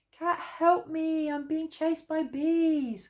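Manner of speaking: high, slow, pleading cry; frightened cry. A narrator's reading. Bear, fleeing the bees, cries out high to Cat. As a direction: beg for help in panic. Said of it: "cried Bear".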